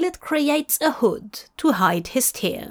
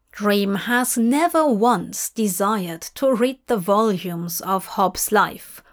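ordinary delivery, nothing marked

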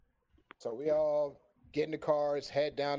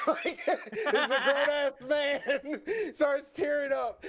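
high squealing laugh through the words, slowed; can barely get it out for laughing; laughing hard; 12 semitones higher, 4 dB louder, much slower than usual. Real, not acted. Laughing while telling story.

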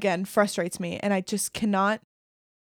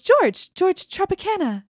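mock teen girl, much higher; 10 semitones higher, slower than usual. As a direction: play the fibbing teen sister. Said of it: "she goes".

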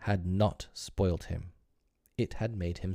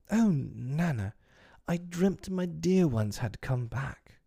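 mild longing; 8 semitones higher, slower than usual. Acted. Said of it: "Mrs Darling waking from a dream".

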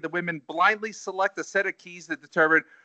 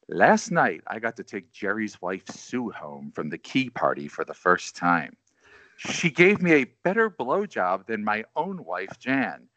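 low, slow late-night radio DJ voice; sultry voice; 7 semitones lower than usual. Pretend. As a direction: read it like a smooth Quiet Storm host. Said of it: "I could be like Quiet Storm here".

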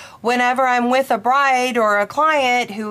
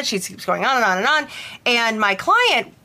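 usual speaking voice

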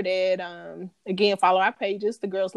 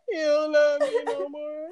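slow, higher, laughing mock sob; imitating Yvette crying; 7 semitones higher than usual. Replaying Yvette's crying line in the car, mock sob. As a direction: drag out a tearful wail while laughing. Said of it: "that episode when Baby Boy Yvette was in that car".

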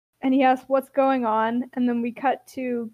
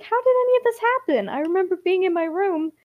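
much higher, fast, rushed and frightened; bewildered panic; 7 semitones higher, faster than usual. Retold or imitated. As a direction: blurt it out in a confused panic. Hero bewildered about losing home.

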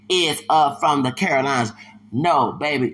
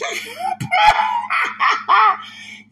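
high shrieking laughter; loud laugh; 4 dB louder than usual. Real, not acted. Laughing loud at a candidate's quote. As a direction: crack up loud.

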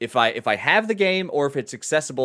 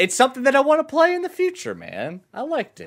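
goofy voice; 4 dB louder than usual. Pretend. Goofy voice after the bit.

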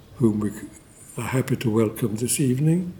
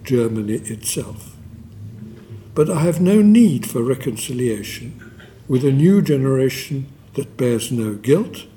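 emphatic quoted reading; 3 semitones higher, 3 dB louder than usual. Acted. Reads memoir quote with emphasis.

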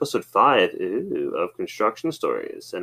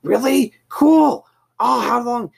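much higher, louder mock eager voice; sudden excitement; 6 dB louder, slower than usual. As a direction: suddenly perk up with interest. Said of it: "He's like".

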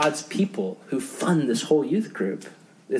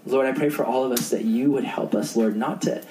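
low, earnest prayer voice; 4 semitones lower than usual. Acted. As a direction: pray humbly before the group. Leads closing prayer.

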